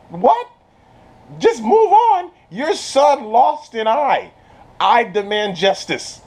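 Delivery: high-pitched, incredulous outburst slowing into a demand; hammed-up indignant mother; mock outrage. Queen demanding justice for her son's eye, mock outrage.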